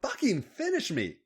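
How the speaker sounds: impatient snarl rising high; acted demanding snarl. A narrator's reading. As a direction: snarl the demand, pitch climbing. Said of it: "Becky snarled".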